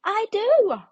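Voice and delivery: high, loud, stubborn little caterpillar; childlike high voice refusing to change. A narrator's reading. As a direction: dig in your heels, small and insistent. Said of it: "said little caterpillar".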